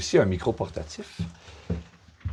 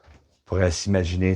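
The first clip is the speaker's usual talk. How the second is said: usual speaking voice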